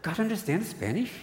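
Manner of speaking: put-on high, loud accented disbelief; amazed father. Father asking son if God really understands Spanish, amazed. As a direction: marvel like an old man hearing news.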